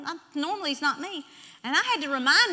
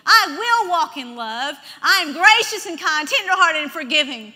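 loud, raised run of declarations; forceful affirmations; 5 semitones higher, 11 dB louder than usual. Shown as a demonstration. Preacher demonstrating words to say daily, forceful and loud. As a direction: proclaim each line at full force. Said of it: "thirty minutes saying".

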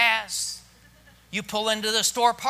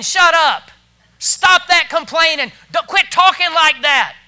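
loud, raised scolding; blunt rebuke; 7 semitones higher, 11 dB louder than usual. Acted. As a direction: tell off the complainers forcefully. Blunt version of Moses' rebuke.

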